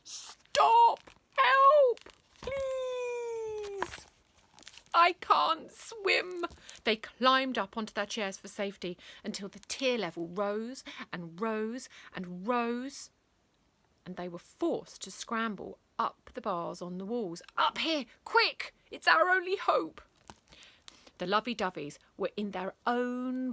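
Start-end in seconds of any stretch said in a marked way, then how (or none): 0.0-6.4 very high, loud, frantic yells; high, desperate cries for help; panicked cries. Acted. Kids drowning in tears. 17.6-20.0 higher, louder urgent call; higher, urgent shout. Acted. Kids climbing wall bars urgently.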